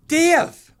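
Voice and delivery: high, loud shout of a name. Retold or imitated. Wife calling him, a loud high shout. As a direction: yell his name across the house.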